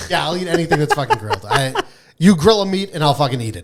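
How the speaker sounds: ordinary talk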